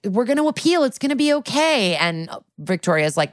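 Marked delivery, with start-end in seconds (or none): 0.1-2.0 higher, gently reassuring promise; reassuring Olivia. Retold or imitated. Detective comforting the upset victim outside court, raised and reassuring. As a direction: soothe her that the appeal will work. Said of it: "Olivia goes after her and she's like".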